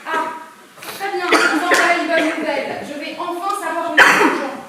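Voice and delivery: loud, gleeful money lust; greedy excitement. Acted. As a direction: gloat over the money. (excitation avide et bruyante)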